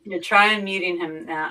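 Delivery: ordinary talk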